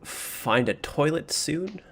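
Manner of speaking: usual speaking voice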